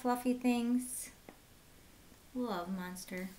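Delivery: usual speaking voice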